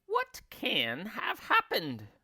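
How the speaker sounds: high, slow puzzled crone; slowly wondering in a high old woman's voice; Missus Twit worried. A narrator's reading. Wife staring at her stick, high and slow. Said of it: "Missus Twit said".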